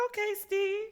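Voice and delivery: high-pitched playful squeal; excited squeal. Retold or imitated. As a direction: squeal his name like a hyped friend. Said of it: "I was like".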